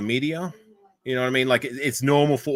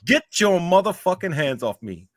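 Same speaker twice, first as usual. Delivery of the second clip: louder, high-pitched mock-angry film line; hammed-up movie outburst; 8 semitones higher, 4 dB louder than usual. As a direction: act an indignant movie hero, voice raised. Acting film lines in American accent.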